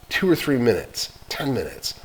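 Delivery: usual speaking voice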